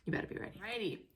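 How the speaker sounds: playful whispered tease; teasing whisper. Real, not acted. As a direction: hush it to the camera playfully. Teasing the viewers.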